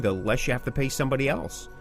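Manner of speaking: ordinary talk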